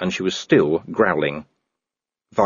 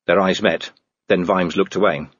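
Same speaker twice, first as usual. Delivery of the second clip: plain reading voice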